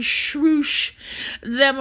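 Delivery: high, loud Baltimore swoosh; Baltimore accent. Acted. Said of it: "in my Baltimore voice".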